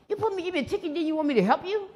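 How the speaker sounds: can't believe his nerve; indignant disbelief. Retold or imitated. Narrator retorts to the cop.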